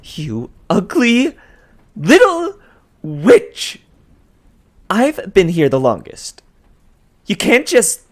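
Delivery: put-on a shrill furious girl losing her cool; furious spluttering. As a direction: spit the insult out in rage. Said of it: "Drew spluttered".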